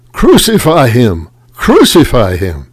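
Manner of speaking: loud cry of the mob; crowd crying out. A narrator's reading. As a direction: shout for his death. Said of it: "they cried out".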